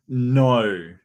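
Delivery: long, loud drawn-out "No" with a gliding vowel; odd pronunciation of no. Shown as a demonstration. Host showing viewers his way of saying no, exaggerated vowel. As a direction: stretch out the vowel of "No". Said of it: "his pronunciation of no is just".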